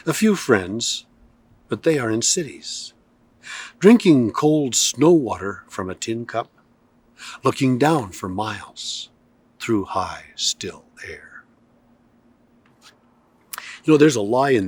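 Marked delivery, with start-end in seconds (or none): 0.1-11.4 measured poetry reading with long pauses between lines; poem reading. Acted. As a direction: let each image hang in the air, unhurried. Poem continues to its end.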